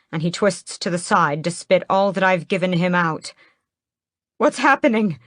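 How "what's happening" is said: gasp. A narrator's reading. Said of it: "I gasp".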